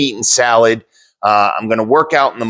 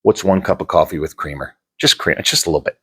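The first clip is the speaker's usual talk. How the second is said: tempted bargaining; 5 semitones lower than usual. Retold or imitated. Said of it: "you wake up like".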